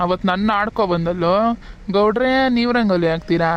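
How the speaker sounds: ordinary talk, nothing marked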